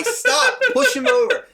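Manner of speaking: high laughing retelling. Real, not acted. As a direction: laugh through the words. Laughing while retelling the push.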